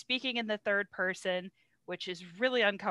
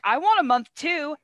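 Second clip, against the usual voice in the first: higher, louder, mock-petulant claim; mock demand; 7 semitones higher, 7 dB louder than usual. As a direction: demand it like a jealous rival, hammed up. Acted demand of Augustus.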